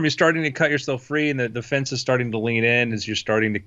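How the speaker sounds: ordinary talk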